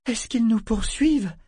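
high, alarmed question; frightened. A narrator's reading. Vivacia looks back at the pursuing galley, frightened. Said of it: "un coup d'œil rempli d'effroi".

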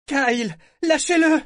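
terrified scream. A narrator's reading. Ship figurehead screaming at Kyle in terror. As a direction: scream at him to let go in panic. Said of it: "s'exclama Vivacia".

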